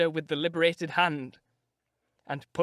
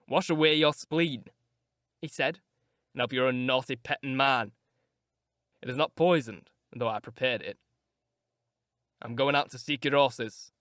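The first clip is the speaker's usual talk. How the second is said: a slightly lower, sardonic voice; Heathcliff mocking; 5 semitones lower than usual. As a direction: offer the tea with a sneer. Heathcliff mocks Nelly.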